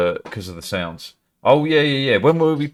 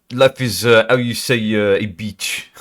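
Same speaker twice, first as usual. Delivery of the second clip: hesitant French speaker's English; French accent. Shown as a demonstration. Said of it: "French and English anyway".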